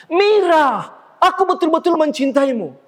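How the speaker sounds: high-pitched, theatrical declaration of love to the back row; projected stage declaration. Shown as a demonstration. Actor declaring love to Mira on stage for the audience, projected theatrically. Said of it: "Di atas panggung wajar kita mengatakan".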